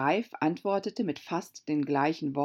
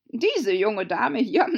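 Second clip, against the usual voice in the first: loud, higher, slow announcement; brusque Gryphon; 5 semitones higher, 7 dB louder, slower than usual. Acted. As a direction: play the Gryphon presenting someone, loudly. Gryphon introduces Alice loudly. (forscher Greif, laut und gedehnt)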